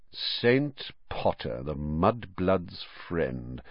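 slow, soft, drawn-out sneer; bully sneering at Potter behind his back; slowly. Acted. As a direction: let every word drip with contempt. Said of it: "said Malfoy slowly".

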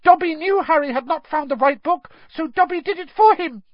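eager, very high elf squeak; high-pitched excited elf; Dobby. A narrator's reading. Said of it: "squeaked the elf".

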